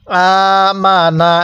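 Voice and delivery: আমানা held slowly, each vowel stretched one alif; slow tajweed elongation of আমানা; drawn-out recitation. Shown as a demonstration. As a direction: chant আমানা slowly for learners to copy. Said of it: "আমার সাথে সাথে পড়বেন".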